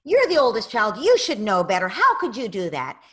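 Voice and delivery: loud mock scolding of the older child; scolding parent. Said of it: "come running and saying".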